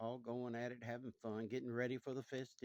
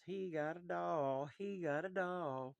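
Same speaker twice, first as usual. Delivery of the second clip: put-on slow, higher sing-song taunt of kids on a bus; teasing kids; 5 semitones higher, slower than usual. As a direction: chant it like schoolkids mocking.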